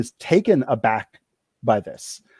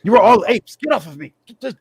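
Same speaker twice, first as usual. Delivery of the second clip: high, loud start to an indignant protest; flustered, angry outburst; 8 semitones higher, 4 dB louder, faster than usual. Acted. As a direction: fend them off in a high, agitated voice. GM voices cornered man shoving player off.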